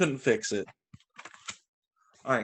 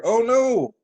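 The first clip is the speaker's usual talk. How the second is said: high, loud storybook oh no; mock alarm; 7 semitones higher, 6 dB louder than usual. Reading to a child, playful mock alarm. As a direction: pretend shock for the child.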